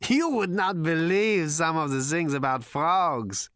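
high, slow, breathless giggly voice; gasping through laughter. Acted. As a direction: gasp it out between laughs. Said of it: "he gasped".

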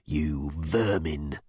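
low, slow growl of contempt; growling menace. A narrator's reading. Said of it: "he growled".

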